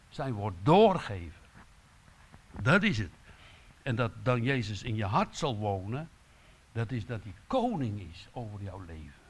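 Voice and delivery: normal talking voice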